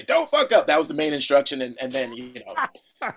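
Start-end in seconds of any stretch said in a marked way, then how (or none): 0.0-0.7 mock order. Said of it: "just to him to say".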